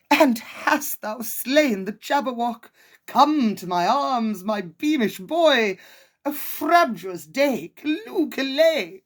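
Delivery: put-on high, loud chortling old father; joyful father. Father greeting his victorious son, high gleeful chortle. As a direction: crow with delight at the returning son. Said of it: "he chortled in his joy".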